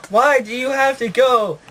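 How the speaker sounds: high-pitched mock-grieving wail; hammed-up high plea; mock anguish. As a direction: lament it like a melodramatic bereaved father. Father voiced crying over dying son.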